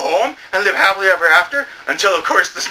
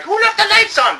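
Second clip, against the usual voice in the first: high squeaky question; silly question. Retold or imitated. Someone in the bright scene, squeaked high. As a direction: pipe up high.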